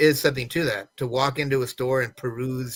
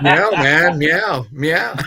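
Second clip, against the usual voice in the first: louder meows through laughter; laughing meow; 2 semitones higher, 6 dB louder, much slower than usual. Acted. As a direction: meow like a cool cat, cracking up. Joking cat noises while laughing.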